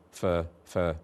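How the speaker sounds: "for" reduced to a weak schwa; schwa demo. Shown as a demonstration. Teacher showing the weak schwa. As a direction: swallow the vowel in "for". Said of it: "vowel schwa there".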